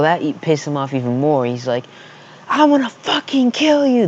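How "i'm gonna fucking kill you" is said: high-pitched imitation of a furious kid; hammed-up, high-voiced rage; mock angry threat. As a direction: mimic an enraged threat, pitched way up. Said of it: "He was just pissed off".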